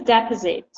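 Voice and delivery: "Deposit" stressed on the first syllable, DE-posit; the noun "Deposit", first syllable stressed. Shown as a demonstration. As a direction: model the noun "Deposit" as DE-posit for the class to repeat. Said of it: "Can you repeat after me?".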